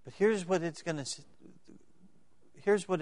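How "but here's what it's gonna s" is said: ordinary talk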